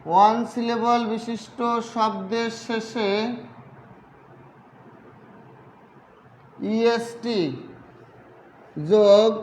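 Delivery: slow, stretched words for writing along; slow dictation. Real, not acted. Teacher dictating the superlative rule to students, slow dictation. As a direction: dictate phrase by phrase with long pauses.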